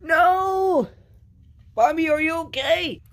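high, loud panicked shouting; shrill-pitched, drawn-out shouts of dismay; alarmed cry. Acted. Falling screen hits a friend.

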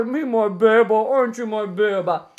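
loud, smothering pet-owner gush. Real, not acted. Owner to his Beba, loud doting baby talk. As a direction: gush over the dog with mushy words.

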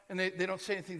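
usual speaking voice

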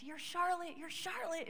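high, loud excited exclaim; telling the sick woman her name is the sign. Retold or imitated. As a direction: burst out with joy. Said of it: "I got so excited".

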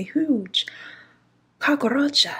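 unvoiced whisper, rising; whispered dread. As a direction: breathe the word in horror. Hushed reveal of the cockroach.

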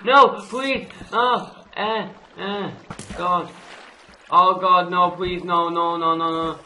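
high, loud yelps turning to rapid begging; panicked pleading. Real, not acted. Gamer caught near lava, panicked pleading. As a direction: beg in panic as disaster strikes.

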